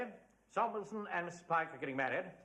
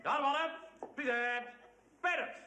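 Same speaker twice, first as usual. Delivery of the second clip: barked drill commands; 10 semitones higher than usual. Acted. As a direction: bark the orders across the hall.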